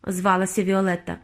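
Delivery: ordinary delivery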